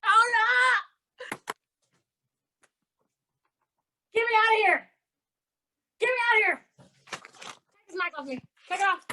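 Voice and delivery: high-pitched comic cries; exasperated pleading. Real, not acted. Host fed up with glitches, high exasperated cries. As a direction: wail to be let out, high and fed up.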